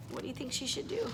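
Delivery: soft, fast breathy ask; whispered suspense question; hushed question. A narrator's reading. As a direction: lean in to the kids. Reader asks kids breathlessly.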